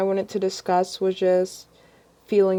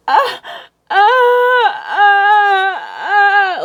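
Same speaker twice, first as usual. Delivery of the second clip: retold screaming; 14 semitones higher, 8 dB louder, much slower than usual. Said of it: "I was screaming".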